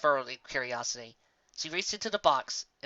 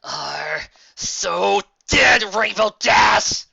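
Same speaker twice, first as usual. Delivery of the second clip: very slow, much louder and higher threat; furious slow shout; 7 semitones higher, 10 dB louder, much slower than usual. Acted. Muddy mare vows revenge on the prankster, furious shout. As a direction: drag each word out in rage. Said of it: "she hissed".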